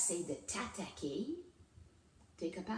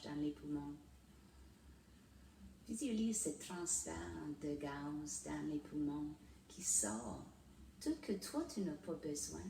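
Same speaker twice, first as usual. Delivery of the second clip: guided meditation; 7 dB quieter than usual. Real, not acted. Host leading viewers through breathing and a mantra, guided meditation. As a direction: ease them into calm, leaving long pauses, softening as you go.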